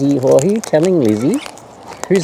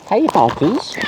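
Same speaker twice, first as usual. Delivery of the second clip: ordinary talk, nothing marked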